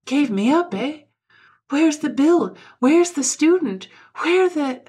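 high, loud, frantic questioning; agitated demands. A narrator's reading. Waking patient demands his bill and the student, agitated.

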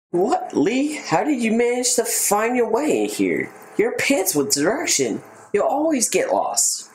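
cheeky game character read; playful big brother teasing. Acted. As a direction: tease your lost little brother.

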